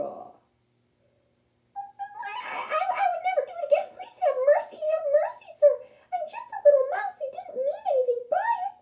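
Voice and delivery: very high, rapid squeaky begging; pleading mouse. A narrator's reading. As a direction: squeak for mercy, loud and frantic. Mouse begs lion for mercy.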